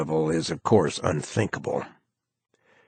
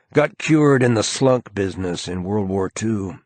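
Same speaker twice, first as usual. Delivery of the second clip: ordinary delivery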